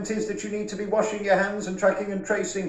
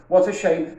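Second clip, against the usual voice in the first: usual speaking voice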